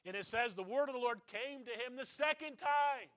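loud, driving preacher's voice; rising pulpit intensity; full-force preaching. Acted. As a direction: preach it out to the congregation.

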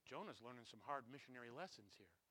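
usual speaking voice

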